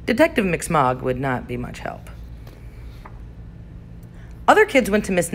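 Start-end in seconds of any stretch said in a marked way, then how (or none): none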